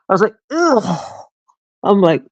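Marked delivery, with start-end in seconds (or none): 0.5-1.3 high, drawn-out grossed-out groan; acted-out revulsion; retold disgust. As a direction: recoil with a mock ugh. Retold disgust at mom's remark.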